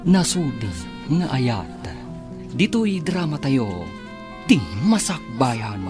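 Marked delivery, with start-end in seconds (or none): none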